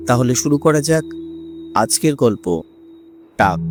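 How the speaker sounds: ordinary delivery, nothing marked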